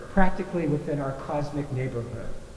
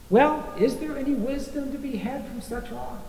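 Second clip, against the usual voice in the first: higher, playful rhetorical question; mock-curious question; 8 semitones higher than usual. Shown as a demonstration. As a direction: ask it as if from the audience. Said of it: "I'm glad you asked that question".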